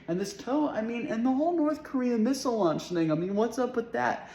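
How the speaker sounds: high-pitched, exasperated rambling; worked-up rant. Acted. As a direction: pitch it well up, overwhelmed by it all. Worked-up rant about toe and missiles.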